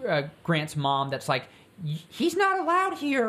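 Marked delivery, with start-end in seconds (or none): 1.8-3.3 high, put-on mom voice, well above his own; squeaky prissy mom, hammed up; mock mom. Imitating Grant's mom, high and prissy.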